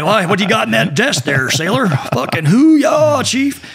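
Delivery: hammed-up eager sailor, high pitch; gung-ho recruit. Would-be sailor to recruiter, hammed-up gung-ho. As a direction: build to a high, drawn-out mock cheer.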